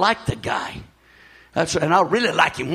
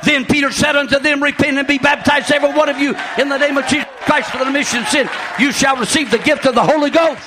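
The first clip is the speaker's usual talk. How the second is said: loud, high shouted sermon; preaching at full force; 9 semitones higher, 5 dB louder than usual. Acted. Pastor shouting at the young people, preaching.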